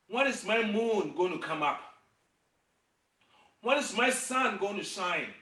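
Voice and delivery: ordinary talk, nothing marked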